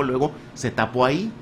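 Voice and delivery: ordinary talk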